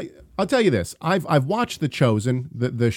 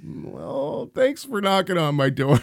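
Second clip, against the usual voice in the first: high, cheerful put-on voice; bright thanks; 6 semitones higher, slower than usual. Host retelling his cheery reply to the neighbor, high and bright. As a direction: chirp it sweetly. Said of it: "And I was like".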